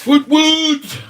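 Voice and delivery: high, loud woot; cheering. Real, not acted. Cheering the reveal.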